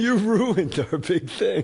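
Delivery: hammed-up indignant accusation; mock angry. Mimics the angry [name] quote.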